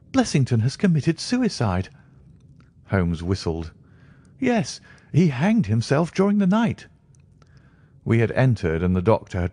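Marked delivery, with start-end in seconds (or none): 0.1-1.9 high, upset doctor voice; a distraught doctor breaking bad news; shaken doctor. A narrator's reading. 4.4-6.9 high, upset doctor voice; a distraught doctor breaking bad news; shaken doctor. A narrator's reading.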